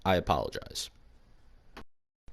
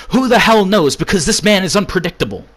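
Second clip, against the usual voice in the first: loud rant; 8 semitones higher, 6 dB louder, faster than usual. Acted. Ranting promo.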